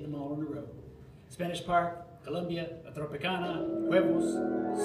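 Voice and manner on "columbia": ordinary delivery, nothing marked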